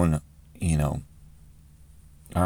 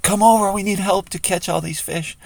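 high-pitched call to other boats; yelling out; 12 semitones higher than usual. Acted. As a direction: call for help across the water, high and urgent. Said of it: "yells out".

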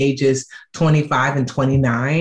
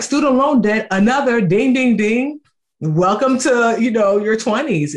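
teasing friend; 7 semitones higher than usual. Retold or imitated. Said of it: "And she's like".

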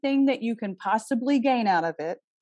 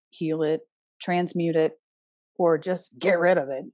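normal talking voice

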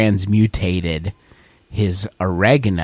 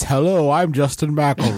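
mock introduction; 5 semitones higher than usual. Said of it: "Real person".

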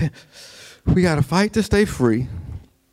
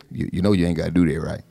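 low, fast sly aside; low, quick sneering whisper into someone's ear; mocking troublemaker; 10 semitones lower, faster than usual. Mocking whisperers undermining Paul.

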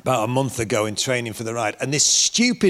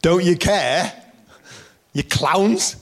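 raised, high mock accusation; acted-out indignant jibe; incredulous rude reproach; 5 semitones higher, slower than usual. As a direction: hurl it like an outraged complaint. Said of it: "saying to him".

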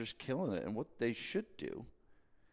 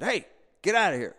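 loud, much higher shout to scare it off; yelling at a bear; 11 semitones higher, 11 dB louder than usual. Retold or imitated. On a porch, shouting at a bear to leave, loud shout. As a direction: bark it out to chase the animal. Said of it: "I start yelling at the bear".

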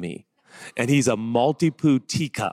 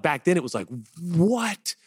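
ordinary talk, nothing marked

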